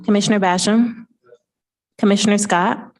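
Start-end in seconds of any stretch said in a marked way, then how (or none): none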